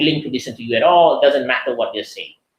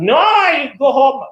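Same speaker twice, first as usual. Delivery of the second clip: shouted much higher and louder; rude dismissal; 13 semitones higher, 7 dB louder than usual. Retold or imitated. Rude arguer shouting down the speaker, a loud mock yell. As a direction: bark them off, rude and dismissive. Rude person refusing to listen.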